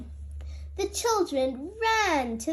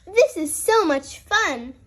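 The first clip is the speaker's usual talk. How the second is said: high, excited and louder; cheering; 6 semitones higher, 4 dB louder than usual. A narrator's reading. Peppa to Susie on the course, excited. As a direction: bubble over with fun. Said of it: "cheered Peppa".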